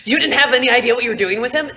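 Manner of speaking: fast, loud, high disbelief; incredulous. Acted. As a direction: fire it back in disbelief. Davin incredulous.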